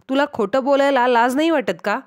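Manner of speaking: ordinary delivery, nothing marked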